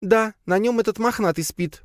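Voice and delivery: high-pitched grumbly character voice; grumbling. A narrator's reading. Lea answers her master about the sleeping cat, grumbling. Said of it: "пробурчала Лея".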